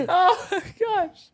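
slow, breathless through laughter; can barely get words out laughing; laughing helplessly. Real, not acted. Friend cracks up laughing.

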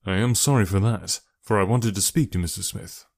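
plain reading voice

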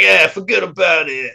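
put-on New York mobster accent. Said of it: "some fucking New Yorker showing up being like".

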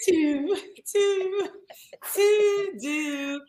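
high, drawn-out "two, two, do" with a fronted vowel; mock Baltimore two. Teasing over her accent, mock Baltimore two. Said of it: "She said two".

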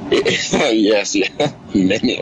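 amused, laughing agreement. Real, not acted. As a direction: agree through a real laugh. Caller laughs at the host's jab.

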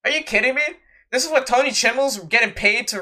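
can't believe what he just read; incredulous. Real, not acted. Disbelief at the stipulation.

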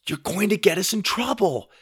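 high, anxious scolding; high-pitched warning; scolding sister. A narrator's reading. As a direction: tell off a little brother. Sister scolds brother at globe.

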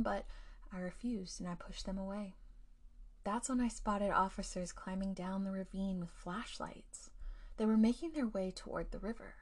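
plain reading voice

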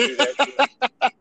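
high, slow, laughing mock veteran drawl; mock Aaron war story. Teasing a friend by imitating his Iraq tales, slow and high. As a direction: ham up a bored vet dragging out an old war story. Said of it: "Aaron will be like".

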